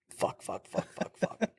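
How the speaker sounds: breathless whispered swearing under the breath; panicked whisper to himself. Retold or imitated. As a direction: hiss the curses so nobody hears. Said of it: "And I was like".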